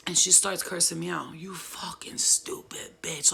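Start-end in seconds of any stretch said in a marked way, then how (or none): 1.4-3.3 slow, rough whispered growl; slow demonic man-voice growl. Retold or imitated. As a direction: imitate a possessed girl in a man's voice. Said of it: "Like, with a man voice".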